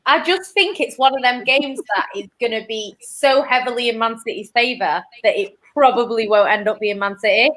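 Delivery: ordinary talk, nothing marked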